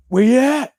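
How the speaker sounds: loud, high yell into the dark; mock ghost-hunter taunt. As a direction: call out a challenge to a ghost. Imitating TV medium taunting ghosts.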